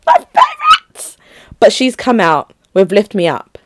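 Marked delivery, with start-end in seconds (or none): 0.1-1.2 extremely high, drawn-out squeak; excited squeal. Real, not acted. Still loving those old favorites, excited high squeal. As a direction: squeal it right at the top of your voice. Gushes after singing favorite song.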